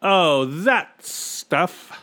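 very slow, high, dismissive drawl; hearty, unbothered character voice; amused captain. A narrator's reading. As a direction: wave it off with amusement. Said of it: "laughed the captain".

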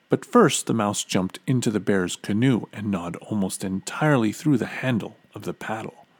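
plain reading voice

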